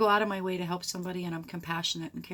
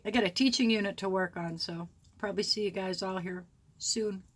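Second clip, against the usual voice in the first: usual speaking voice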